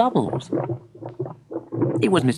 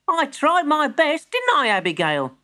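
put-on young boy voice; 13 semitones higher than usual. A narrator's reading.

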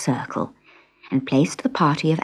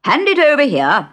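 high, loud pompous command; dodo voice; 10 semitones higher, 6 dB louder than usual. Acted. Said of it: "said the dodo".

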